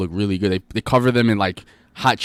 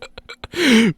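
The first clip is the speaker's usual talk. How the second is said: high, helpless laugh; real laughter; 12 semitones higher than usual. Real, not acted. Friends cracking up over corn dogs, high laughter. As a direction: crack up at the silly bit.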